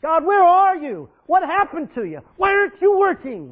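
a believer loudly demanding that God act; demanding prayer. Retold or imitated. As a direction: nag God like a spoiled child. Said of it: "we always say".

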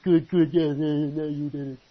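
loud excited cheer from the buddies; friends cheering. Retold or imitated. Kid's friends egging him on after the theft, loud and excited.

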